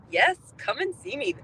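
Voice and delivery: jumps way up high in pitch, delighted; thrilled high-pitched welcome; eager yes. Retold or imitated. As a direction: gush the invitation like you can't wait. Eager welcome to visiting friends.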